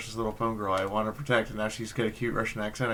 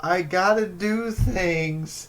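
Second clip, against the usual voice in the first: higher, louder, slow exasperated scolding; scolding the cat; 8 semitones higher, 8 dB louder, much slower than usual. Real, not acted. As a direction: lecture the cat, drawing it out. Scolding the cat mid-stream.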